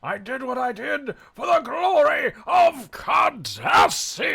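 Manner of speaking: loud, high, slow villain shout; villain proclamation. Acted. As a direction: proclaim it like a proud Cardassian officer. Quoting a Star Trek villain.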